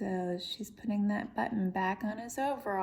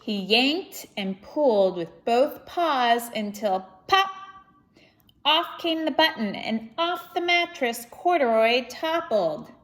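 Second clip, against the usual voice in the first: lively, expressive storybook reading; reading to children; 4 semitones higher, 9 dB louder than usual. Acted. Reading Corduroy aloud to children, lively storybook voice. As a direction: read it out to a young audience with energy. Reads picture book aloud.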